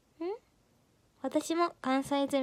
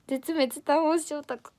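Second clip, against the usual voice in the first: 絶滅端推しオタク, slow and a bit higher, in a put-on voice; a hammed-up announcer reading 絶滅端推しオタク; dramatic title announce; 3 semitones higher, slower than usual. Shown as a demonstration. Repeating the title to viewers, slow and hammed-up. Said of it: "それでは聞いてください".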